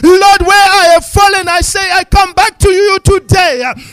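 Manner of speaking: loud, building prayer, rising to full force; fervent preaching prayer. Real, not acted.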